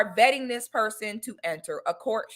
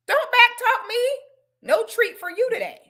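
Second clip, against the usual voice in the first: high-pitched telling-off; high mock-stern voice; playful scolding; 11 semitones higher than usual. Real, not acted. Scolds the dog like a child.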